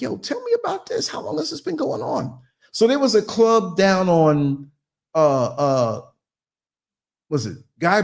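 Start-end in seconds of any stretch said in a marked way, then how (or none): 0.0-2.4 put-on nosy young self. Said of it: "I'm like".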